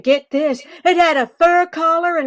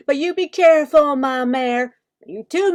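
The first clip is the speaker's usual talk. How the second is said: ordinary delivery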